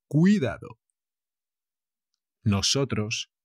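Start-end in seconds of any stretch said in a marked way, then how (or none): 0.1-0.8 Cuidado said louder with a falling tune; warning. Shown as a demonstration. Teacher warns students about the written accent. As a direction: warn them with a firm Cuidado. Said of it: "cuidado que esto siempre lleva el acento".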